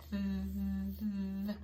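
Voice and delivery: th- with the tongue stuck out, held; th sound. Shown as a demonstration. Teacher shows the class the th- sound. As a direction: push the tongue out and hiss th-. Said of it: "feel where you're sticking out your tongue".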